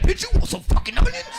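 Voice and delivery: high, loud bickering wife; hammed-up wife yelling from the fridge; mock newlywed shout. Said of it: "She look in the refrigerator like".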